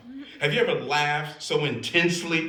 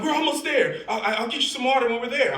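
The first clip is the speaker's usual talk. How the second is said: high, loud, hurried promise; acted panicky reassurance, speeding up; frantic reassurance; 11 semitones higher, 5 dB louder, faster than usual. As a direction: rush it out loud and high, acting flustered. Acted driver rushing to reassure.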